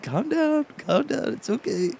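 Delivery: high, strained self-talk; self-soothing. Retold or imitated. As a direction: reenact trying to calm yourself mid-meltdown. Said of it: "Tell myself".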